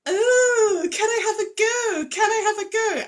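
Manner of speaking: high, loud sing-song mimicry of a child's phrase; mocking imitation. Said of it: "have made fun of me".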